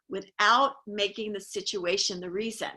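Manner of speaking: normal talking voice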